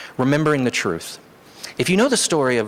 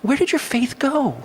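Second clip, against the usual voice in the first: much higher, slowed voice for Jesus; Jesus questioning disciples; 12 semitones higher than usual. Retold or imitated. Jesus asks frightened disciples in the storm, high and pleading. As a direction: lift it up high and ask it slowly, almost pleading. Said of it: "He looks at them and says".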